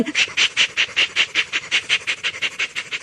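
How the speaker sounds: whispered shh puffs fading away; unvoiced chuffing dying off; fading engine puffs. A narrator's reading. Puffing fades.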